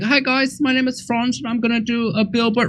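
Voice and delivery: high-pitched camp German impression; high, put-on German voice; camp German guy.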